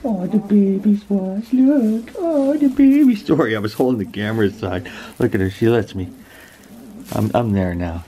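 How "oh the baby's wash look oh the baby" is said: high, drawn-out cooing; high squeaky pet voice. Real, not acted. Baby talk to the animals.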